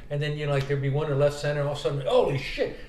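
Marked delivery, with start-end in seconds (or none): 2.1-2.8 high, excited outburst; excited exclamation. Retold or imitated. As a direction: blurt it out, delighted. Retold exclamation at a hit.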